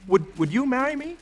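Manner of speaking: eager hope. Acted. Prince hopes she'll marry him.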